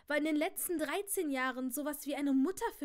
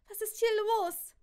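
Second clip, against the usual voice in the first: high, loud frightened question; frightened cry; 9 semitones higher, 9 dB louder than usual. A narrator's reading. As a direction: cry out in fear. Sophie frightened.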